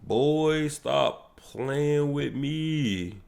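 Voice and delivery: overjoyed streamer can't believe the news; hyped disbelief. Real, not acted. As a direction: stretch it out in happy disbelief. Hyped reaction to trailer news.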